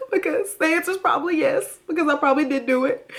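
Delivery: high, hammed-up mock sobbing; fake crying. Mocking a cheater who cries as a diversion, fake crying. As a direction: put on tearful outrage to dodge the question. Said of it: "Evelyn starts to cry, a diversion".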